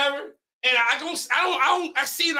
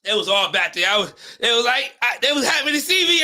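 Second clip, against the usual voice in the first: usual speaking voice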